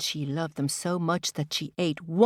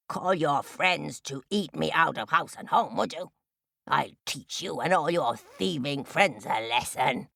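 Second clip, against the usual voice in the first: low, threatening crow with harsh caws; menacing crow; 4 semitones lower than usual. Acted. Crow threatens to cook the turtle, low menace. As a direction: threaten like a villainous crow, relishing it.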